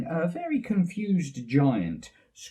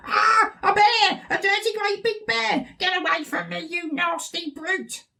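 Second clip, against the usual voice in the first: witch screaming. Acted. Witch terrified of Daddy Bear looming over her, screaming. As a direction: shriek in terror at the bear. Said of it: "she screamed".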